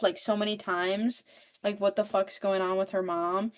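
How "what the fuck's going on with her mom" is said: ordinary delivery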